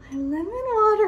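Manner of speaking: high sudden realization; surprised. Real, not acted. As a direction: yelp it, suddenly remembering. Suddenly remembers her drink.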